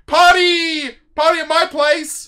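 high, loud party call; party shout. Real, not acted. Streamer announcing a late-night party, shouting. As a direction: invite everyone over like a hype host.

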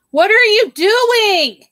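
high, loud, slow shout across the house; calling out. Real, not acted. Calling out to her child.

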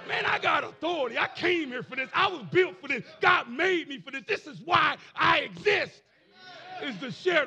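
high, loud sermon shout; full-force preaching. Acted. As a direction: preach it in punchy, building bursts.

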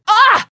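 high, loud startled cry; sudden shock scream. Acted. Survivor caught off guard by zombies, startled scream. Attack breaks through.

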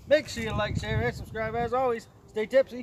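loud, high sign-off; rally the viewers; hyped vlog outro. Real, not acted.

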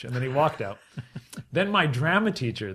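normal talking voice

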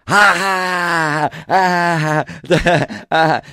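high loud cackling laugh; mock crowd laughter. Imitating the crowd laughing with the wife, loud. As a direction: laugh like a delighted room. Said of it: "applause breaks".

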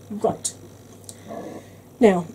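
rough, growling sore throat. Real, not acted. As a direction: talk through a growling throat. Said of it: "I apologise for my throat growling at you".

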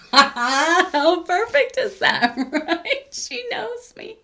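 giggling with delight over the card; delighted laugh. Real, not acted. Delighted by the card's back.

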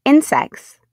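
slow, loud, delighted; enthusiastic read. A narrator's reading. Reading to kids, slow and loud with delight. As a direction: savour every word of it.

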